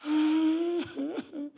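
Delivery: high, loud mock wail; imitated sob. Preacher imitating the husband starting to cry, high wail. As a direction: break into a put-on crying sound. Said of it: "ele começou a chorar".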